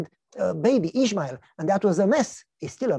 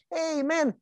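much higher mocking neighbor voice; teasing neighbor; 10 semitones higher than usual. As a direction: needle him like a nosy neighbor. Neighbor Jim teases Abraham.

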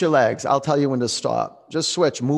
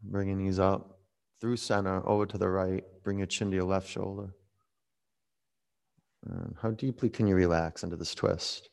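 guided relaxation; 3 semitones lower, 7 dB quieter than usual. Acted. Quiet guided relaxation in twist.

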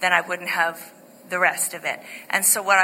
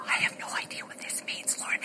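unvoiced whisper, barely audible; whispered prayer; 11 dB quieter than usual. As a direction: whisper it to God so nobody hears. Whispered prayer before approaching.

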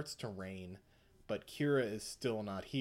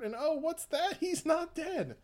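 much higher, louder mock shock; mock surprise; 4 dB louder than usual. Recapping the twist for the co-host, mock surprise. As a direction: fake a startled gasp of disbelief. Narrator feigns shock Kira survives.

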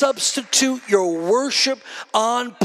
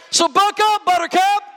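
rallying cry; 8 semitones higher than usual. Real, not acted. Preacher rallying the congregation.

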